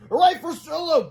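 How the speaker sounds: high, loud hooray; cheering. A narrator's reading. Boy cheers hamster rescuer, high and loud. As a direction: whoop it up high. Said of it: "cried Harold".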